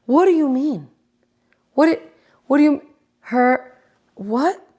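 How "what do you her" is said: normal talking voice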